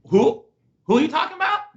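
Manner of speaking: high, loud incredulous question; playful disbelief, voice shooting up; mock incredulity. Shown as a demonstration. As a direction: act baffled, pitch leaping up. Acting out baffled denial of remarks.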